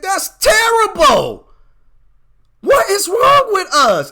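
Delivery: fired up. Real, not acted. Preacher telling his audience the story outrages him, loud and fired up. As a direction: let real outrage burst out. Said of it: "I'm sorry, I got fired up".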